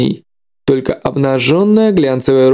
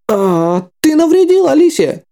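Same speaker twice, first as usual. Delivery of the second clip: very high, shrieking doll voice; an offended haunted toy cries out; jerky animatronic voice; 13 semitones higher than usual. Acted. Doll Alice is hurt.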